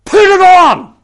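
commanding shout. Acted. Paul ordering believers to put on their armour, a huge shout. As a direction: bellow it like a drill sergeant. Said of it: "Paul says it like this".

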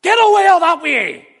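very high, loud Northern Irish yell; Northern Irish dismissive shout. Acted. Preacher gives the Northern Ireland version, comic dismissive yell. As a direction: shoo them off with a broad Northern Irish shout. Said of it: "the Northern Ireland version".

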